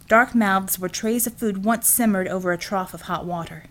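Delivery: ordinary delivery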